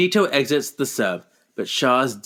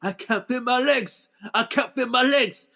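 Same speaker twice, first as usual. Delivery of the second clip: higher, hammed-up wail of despair; mock anguished cry; 10 semitones higher than usual. Recapping Charles losing his legs, hammed-up anguished cry. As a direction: play the tragedy for laughs.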